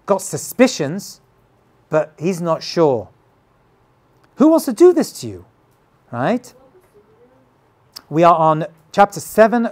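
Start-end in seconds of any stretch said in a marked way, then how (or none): 4.4-5.5 high, fast, urgent king's question; pressing who wants to do this. Acted. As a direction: fire the question off quickly and high. Teacher voices the king's question.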